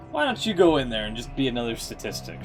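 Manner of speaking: usual speaking voice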